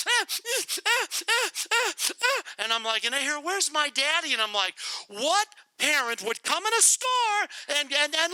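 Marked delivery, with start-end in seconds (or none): 0.1-2.4 put-on high child whimpers; mock child sobs; little voice crying. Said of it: "this little voice". 3.4-4.3 put-on higher child voice asking; lightly voiced lost toddler; little voice. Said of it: "this little voice".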